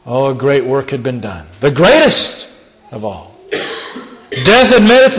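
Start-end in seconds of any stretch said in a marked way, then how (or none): none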